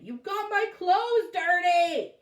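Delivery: high, loud, slow whine; whiny protest. A narrator's reading. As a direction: wail it like a muddy kid. Bradley upset after falling.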